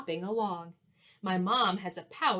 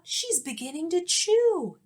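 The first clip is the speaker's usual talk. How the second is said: alarmed cry; 8 semitones higher, 4 dB louder than usual. Acted. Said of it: "I cried out".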